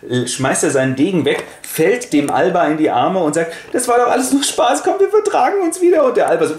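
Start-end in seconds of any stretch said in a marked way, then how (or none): 3.7-6.0 very high, loud sugary voice; fake cheerful make-up. Prince pretends to the queen it was all fun, high and loud. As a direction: gush it to cover the fight. Prince fakes friendliness after fight.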